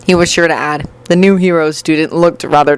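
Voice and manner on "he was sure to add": plain reading voice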